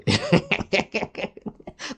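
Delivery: high, rising derisive laugh; long high scornful cackle; mocking laugh. Real, not acted. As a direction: laugh at him, not with him. Laughs at Tariq Nasheed's women.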